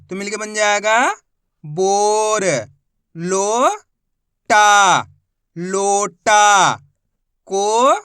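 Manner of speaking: loud, drawn-out syllables, each sounded separately; a teacher chanting sounds for kids to repeat; sounding out Hindi syllables for a class; slow phonics drill. Shown as a demonstration.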